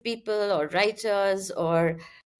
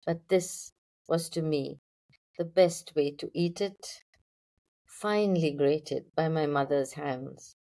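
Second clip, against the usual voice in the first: poetry reading; 5 semitones lower than usual. Acted. Poet reads the title poem for podcast listeners, measured poetry reading. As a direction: read the poem out line by line, unhurried.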